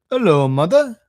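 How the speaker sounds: lightly voiced hearty engine driver; a hint of a friendly driver. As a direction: hail her loudly, lightly in character.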